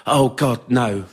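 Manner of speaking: usual speaking voice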